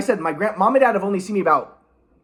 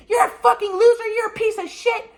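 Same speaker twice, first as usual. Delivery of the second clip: much higher shrill-free mom voice, yelling; mocking mom. Imitating his mom raging on the phone, much higher. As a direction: mimic mom screaming at him. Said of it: "she screams at me".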